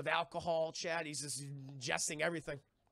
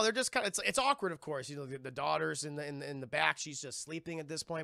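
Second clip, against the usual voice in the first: normal talking voice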